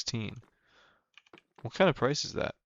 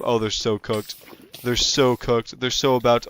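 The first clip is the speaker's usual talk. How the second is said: normal talking voice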